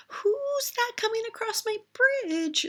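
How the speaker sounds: high, frightened squeak of a little ogre; high squeaky little ogre voice. A narrator's reading. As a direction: squeak it out, nearly fainting. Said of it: "squeaked out Little Ogre".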